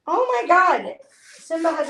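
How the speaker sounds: high, loud startled exclamation; sudden high oh my God; surprised. Real, not acted. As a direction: caught off guard by the slobber. Notices dog slobber.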